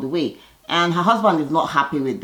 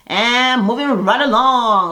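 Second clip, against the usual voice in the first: drawn-out, high, loud "moving right along"; showy transition; 7 semitones higher, 6 dB louder, slower than usual. Real, not acted. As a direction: announce the next scene like a show host. Recap host's signature transition.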